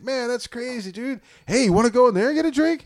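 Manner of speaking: high, eager stranger, rushing the invite; high eager imitation of the stranger; stranger's voice. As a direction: mimic the pushy stranger, high and fast.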